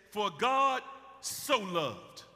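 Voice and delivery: For God so loved, each word drawn out slowly; slow, savoring recitation; slow and deliberate. Shown as a demonstration. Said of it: "Y'all read it too fast".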